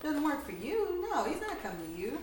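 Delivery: ordinary talk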